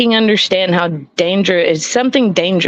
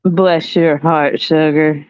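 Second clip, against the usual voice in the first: slow, lower, lightly Southern drawl; slow Southern accent; 5 semitones lower, slower than usual. Shown as a demonstration. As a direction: drag it out Southern-style, lightly. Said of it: "Say it slow with a slight Southern accent".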